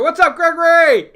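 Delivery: loud, high hello; big cheerful greeting; excited shout-out. Real, not acted. Shout-out to viewer.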